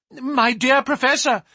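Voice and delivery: high, loud appeal; alarmed, pleading exclamation; urgent cry. A narrator's reading. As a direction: cry out to restrain him. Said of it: "he cried".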